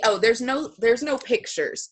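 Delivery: ordinary talk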